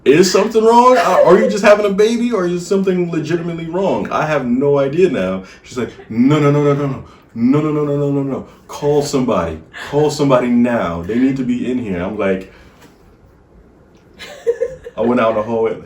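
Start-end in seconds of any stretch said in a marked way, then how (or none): 0.0-5.4 higher and louder, retold worried questions; alarmed. Husband retelling his own worry during labor, higher and louder. 6.1-12.0 imitated panicked pleading, louder; hammed-up urgent no no no; mock panic. Husband imitating his wife begging for a nurse, louder.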